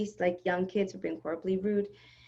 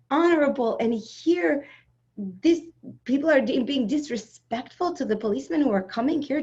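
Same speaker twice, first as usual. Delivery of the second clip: higher, louder indignant tirade; heated rant; 8 semitones higher, 6 dB louder than usual. Retold or imitated. Mother scolding rude wedding guests, heated and loud. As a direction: lecture them, worked up and indignant.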